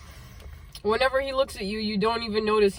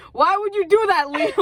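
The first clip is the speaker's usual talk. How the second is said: high, louder melodramatic protest; mock scream; 8 semitones higher, 5 dB louder than usual. Girl screaming at Liam after the punch, mock scream. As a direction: cry out in hammed-up outrage. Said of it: "you're screaming at him like".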